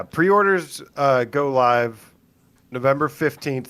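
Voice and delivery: ordinary talk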